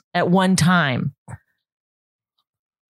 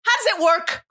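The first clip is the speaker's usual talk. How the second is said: terse, high, clipped question; clipped demanding voice; 9 semitones higher than usual. Retold or imitated. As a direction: snap it out like a pushy customer. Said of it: "in that clipped kind of voice".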